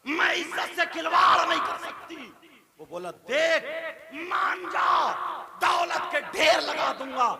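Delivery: loud, high dramatic sermon storytelling; impassioned preacher acting out a defiant exchange; full-force preaching. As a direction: thunder the tale out to the crowd.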